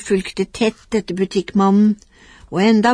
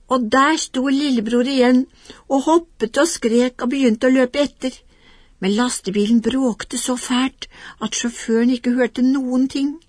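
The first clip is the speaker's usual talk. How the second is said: ordinary delivery, nothing marked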